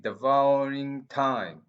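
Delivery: devouring time said slowly as one linked foot, loud and drawn out; read as one foot. Shown as a demonstration. Teacher reciting the verse phrase to the class, slow and emphatic. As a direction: sound the two words as a single metrical foot. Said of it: "recite those two words as one feet".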